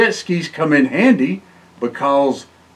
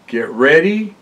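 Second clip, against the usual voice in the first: ordinary delivery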